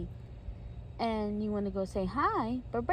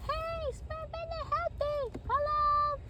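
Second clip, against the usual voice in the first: very high, drawn-out greeting; slow high-pitched hello; listener character's voice; slower than usual. Acted. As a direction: greet them in a squeaky voice. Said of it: "You said".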